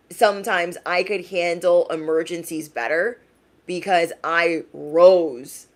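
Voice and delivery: ordinary delivery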